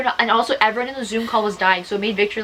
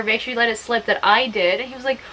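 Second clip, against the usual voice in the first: ordinary delivery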